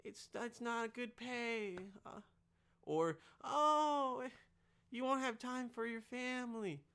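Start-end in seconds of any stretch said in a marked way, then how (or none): 0.0-1.8 high, soft, slow naysayer voice; mock doubters. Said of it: "People are gonna say". 3.4-6.8 mocking put-on worry; mock doubters. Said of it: "People are gonna say".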